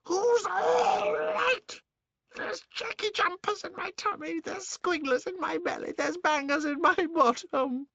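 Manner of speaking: high, slow cranky crone's wail; old hag complaining. Acted. Grandma complaining after medicine.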